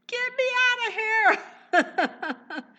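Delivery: a hammed-up Daniel begging from the lions' den, high and loud; mock panic. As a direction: wail it like a terrified prisoner. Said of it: "He could have said".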